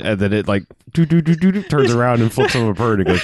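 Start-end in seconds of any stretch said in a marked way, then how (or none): 0.9-1.5 imitated movement noise. Imitating a robot arm.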